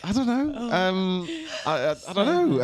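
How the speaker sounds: high, hesitant stammering reply; flustered stammer. Retold or imitated. Caught off guard by the girls, a high flustered stammer. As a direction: play it lost for words. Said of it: "I'm like".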